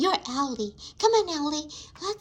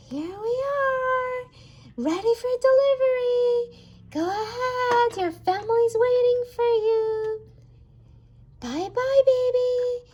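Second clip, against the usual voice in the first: cheery toy delivery character; 9 semitones higher, 5 dB louder than usual. Pretend. Toy courier sends the baby off to its family, high cheery voice. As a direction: call it out high and singsong.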